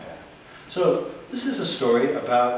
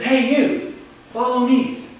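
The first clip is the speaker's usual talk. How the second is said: bystander calling out; 8 semitones higher, 6 dB louder, slower than usual. Retold or imitated. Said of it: "to me he says".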